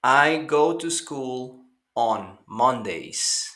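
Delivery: slow, careful English model reading; slow teacher model; slow model sentence. Shown as a demonstration. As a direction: say it slowly for students to repeat. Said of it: "slow model sentence for repeating".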